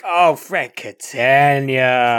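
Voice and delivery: loud, long drawn-out name; mock lament. Co-host fondly bemoaning a reality star, loud drawn-out mock lament. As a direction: wail the name out slowly, hammed up.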